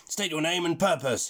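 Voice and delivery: demanding. Acted. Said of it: "he demanded".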